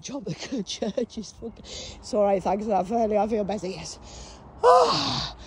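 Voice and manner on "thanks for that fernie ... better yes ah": normal talking voice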